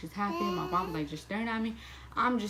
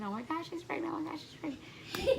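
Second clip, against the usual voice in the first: high squealing mock shriek of a thrilled best friend; imitating a girl squealing with joy; excited screaming friend; 9 semitones higher than usual. Best friend overhears the positive test, imitated high-pitched excited squeal. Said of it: "my best friend screaming".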